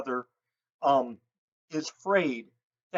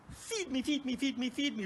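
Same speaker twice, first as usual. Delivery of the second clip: lightly voiced begging bird, higher and softer; begging owl; 5 semitones higher, 6 dB quieter than usual. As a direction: plead like a hungry baby owl. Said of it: "which is him saying".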